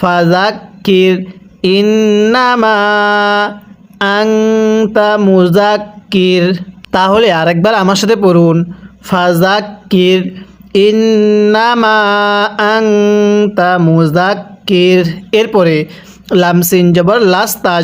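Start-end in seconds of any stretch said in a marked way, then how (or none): 0.0-6.6 slow chant, ইন্নামা stretched long and আংতা hummed through the nose; measured model recitation with long মা in ইন্নামা, nasal আংতা; madd and ghunnah. Shown as a demonstration. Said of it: "তিন আলিফ টেনে পড়তে হয়". 9.0-15.1 slow chant, ইন্নামা stretched long and আংতা hummed through the nose; measured model recitation with long মা in ইন্নামা, nasal আংতা; madd and ghunnah. Shown as a demonstration. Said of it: "তিন আলিফ টেনে পড়তে হয়".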